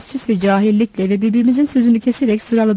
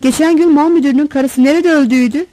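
higher, lightly voiced Central Anatolian accent; thin Central Anatolian voice; 5 semitones higher, 4 dB louder than usual. A narrator's reading. Passenger asks about a recent death, thin Central Anatolian accent. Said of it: "ince, çatlak bir ses ve temizliğini kaybetmeye başlamış bir Orta Anadolu şivesiyle".

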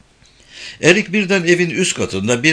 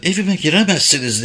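a woman's raised cry, higher; climbing the stairs, she shouts at the intruders, a mild higher yell; 6 semitones higher than usual. A narrator's reading. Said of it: "diye bağırıyordu".

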